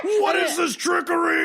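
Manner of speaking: high, slow hammed-up outrage; mock outrage. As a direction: play it scandalised and huge. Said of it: "I would act so outraged".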